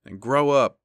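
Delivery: barked loud at the end of the rant; angry shout. Real, not acted. Podcaster yelling at Tom Brady to grow up, loud. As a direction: yell it like a fed-up fan.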